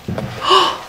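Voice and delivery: sharp breathy intake of surprise; surprised gasp. A narrator's reading. Sal gasps at her lost tooth, sudden surprised gasp. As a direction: gasp as if something's suddenly missing. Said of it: "she said with great surprise".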